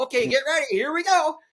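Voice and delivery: high, rising build-up to the jump; hyped up. Retold or imitated. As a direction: pump yourself up before the stunt. Hyping before diving into bush.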